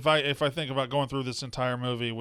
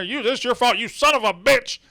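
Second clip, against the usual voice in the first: hammed-up angry dad yelling, higher and louder; mock yelling; 8 semitones higher, 5 dB louder than usual. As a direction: overplay a furious father blaming someone. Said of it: "He's just yelling like that".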